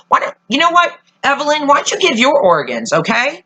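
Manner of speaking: high-pitched mocking jab at the character; mocking Evelyn. Fan taunting Evelyn for harvesting organs, high mocking voice. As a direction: sneer it at her like a taunt. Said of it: "mock addressing Evelyn character".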